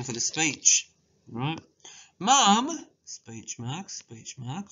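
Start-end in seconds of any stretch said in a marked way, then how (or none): 2.2-2.9 high, loud call for Mum. Acted. Child calling mother in story.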